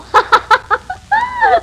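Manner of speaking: a high burst of mocking laughter; laughing. Real, not acted. [name] laughing at Jack's boast about the girl, mocking laugh. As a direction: laugh right in his face. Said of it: "What are you laughing at?".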